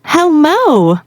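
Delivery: thrilled, high-pitched hello; excited hello. Shown as a demonstration. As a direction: burst with excitement on the greeting. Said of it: "what if you're really excited".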